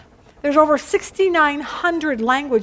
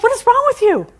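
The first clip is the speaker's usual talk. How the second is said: high-pitched mock exasperation; exasperated foreman; 10 semitones higher, 4 dB louder than usual. Foreman to a worker who can't understand, exasperated. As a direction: act out a baffled foreman, pitched way up.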